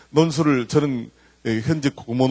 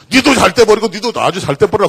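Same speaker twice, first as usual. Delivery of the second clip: loud, higher blessing tossed off; hearty mock blessing; 7 semitones higher, 8 dB louder than usual. Isaac blessing Esau the Korean way, loud and hearty. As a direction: bless him loudly and freely.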